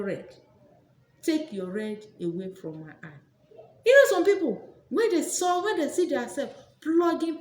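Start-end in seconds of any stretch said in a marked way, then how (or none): 3.9-7.4 high, loud burst; loud outburst. Real, not acted. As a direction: suddenly raise the voice to the crowd.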